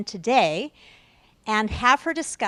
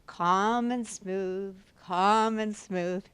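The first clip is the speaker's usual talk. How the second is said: very slow, drawn-out soothing delivery; calm and smooth; 2 semitones lower, much slower than usual. Shown as a demonstration. Speaker showing the audience smooth calming rhythms, slow and soothing. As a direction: stretch each word into one smooth, even rhythm.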